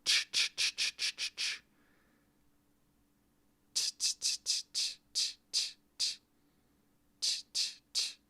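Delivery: "ch" as a voiceless hiss, repeated with rounded lips; drilling the ch sound. Shown as a demonstration. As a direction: push out short breathy "ch" sounds like an O shape. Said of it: "someone said for the C Z, like a ch".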